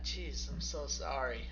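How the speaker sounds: usual speaking voice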